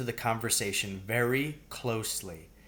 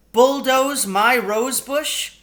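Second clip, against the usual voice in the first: high, loud and slow outrage; indignant; 13 semitones higher, 10 dB louder, much slower than usual. A narrator's reading. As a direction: throw the words back in disbelief. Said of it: "said Missus Fitzgibbon indignantly".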